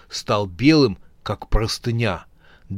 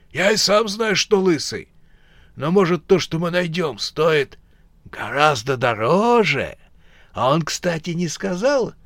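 higher, lively bandit voice; voiced bandit arguing; 5 semitones higher than usual. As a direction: argue like a greedy old bandit. Two characters argue in voices.

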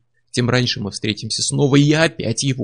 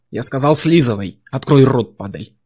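loud barking command, dropping on the last word; shouted order; 6 dB louder than usual. A narrator's reading. Barbarian shouting orders at the priestess. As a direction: bark the order at her.

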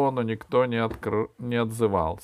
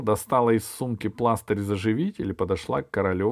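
ordinary delivery, nothing marked

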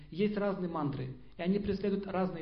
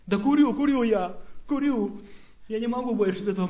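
loud, higher, giving in; desperate outburst; 3 semitones higher, 8 dB louder than usual. Acted. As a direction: burst out in defeat. Acted giving in to craving.

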